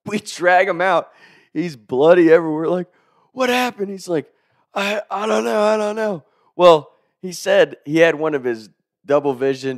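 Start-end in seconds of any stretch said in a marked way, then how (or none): 3.3-3.9 alarmed question. Retold or imitated. Retold alarmed question to hurt friend. 4.7-6.2 dazed stammer. Retold or imitated. Retold dazed hurt friend answering.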